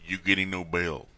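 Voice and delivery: low mock-judge ruling; stern judge. Judge cutting short the celebration, sternly. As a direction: drop the voice low to deny it. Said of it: "they were like".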